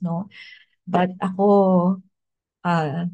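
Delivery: ordinary talk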